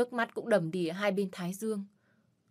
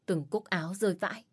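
plain reading voice

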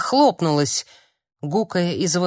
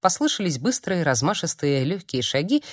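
ordinary delivery

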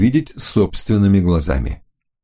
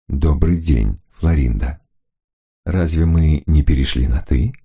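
lower, smooth male voice; charming lower gentleman; man's voice; 4 semitones lower than usual. Acted. Hunter answers Florinda.

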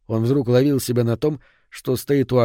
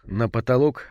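usual speaking voice

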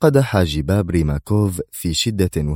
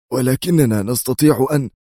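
higher, urgent exclamation; the general bursts out in protest, raised pitch; exclaiming general; 6 semitones higher than usual. Acted. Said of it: "وهو يهتف".

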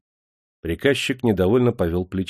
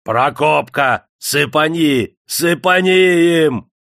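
громко, высоко, медленно, с восторгом; ликуя, подзадоривает; ликующий крик; 6 semitones higher, 6 dB louder, slower than usual. A narrator's reading. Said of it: "Никита Никитич ликовал". (Никита в восторге подгоняет племянника, громко)

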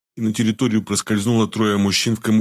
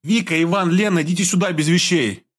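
higher calling out across the yard; loud call; 8 semitones higher than usual. A narrator's reading. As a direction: call the friends over. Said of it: "громко крикнула".

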